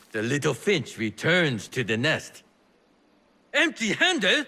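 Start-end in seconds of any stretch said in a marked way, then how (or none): 3.5-4.4 high, laughing tease; amused mocking question; teasing laugh. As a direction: laugh it out as a playful jab. Teasing Basim on return.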